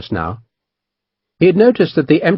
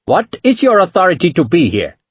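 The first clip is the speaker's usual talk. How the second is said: a hint of an indignant merchant; demanding; 6 semitones higher than usual. A narrator's reading. Merchant challenging the captain's right to be there, demanding. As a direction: demand it with offended dignity. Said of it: "Panjit demanded".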